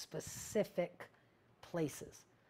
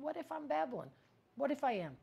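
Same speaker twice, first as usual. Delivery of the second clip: much higher voice, pushing the question; high-pitched questioning; 9 semitones higher than usual. Acted. Speaker voicing self-doubt to listeners, high-pitched questioning. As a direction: toss the question up high, then repeat it. Acts out her own self-doubt, then shrugs it off.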